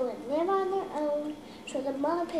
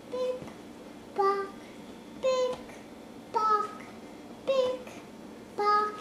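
high hoof clip-clop; 8 semitones higher, slower than usual. Acted. Footsteps again.

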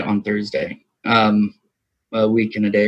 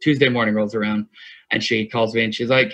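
ordinary talk

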